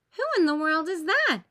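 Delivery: high, loud astonished question; baffled at the odd costume; loud high who-is-that; puzzled surprise. A narrator's reading. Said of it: "asks Lucy".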